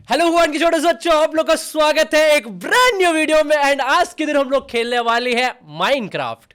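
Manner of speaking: high, loud gaming-video greeting; shouted catchphrase intro. Shown as a demonstration. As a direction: shout it like a hyped YouTuber opening. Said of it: "बहुत चिल्ला के बोलना पड़ता है".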